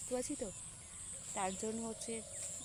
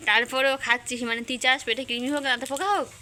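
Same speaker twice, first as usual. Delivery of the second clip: scolding; 2 semitones higher, 8 dB louder than usual. Real, not acted. Mother scolding child over sweets.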